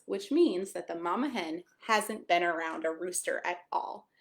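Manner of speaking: ordinary delivery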